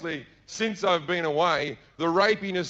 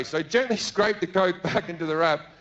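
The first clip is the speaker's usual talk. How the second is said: ordinary delivery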